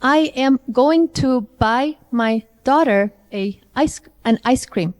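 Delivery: "I am going to" said slowly and in full, each word separate; slow careful dictation, gaps between "buy", "my", "daughter". Shown as a demonstration. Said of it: "say it to me in a connected speech".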